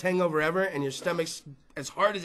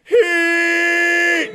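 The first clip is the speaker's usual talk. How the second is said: mock Bigfoot call, high and loud; 8 semitones higher, 7 dB louder than usual. Comic to crowd, doing a Bigfoot call, loud mock howl. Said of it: "You know".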